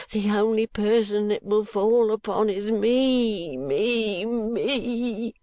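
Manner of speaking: spiral into self-pity; frantic old miser. Acted. Gride's frantic rant.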